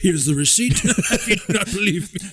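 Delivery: put-on hammy old man voice. Joins the old man bit.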